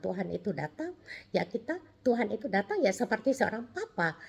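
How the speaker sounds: ordinary talk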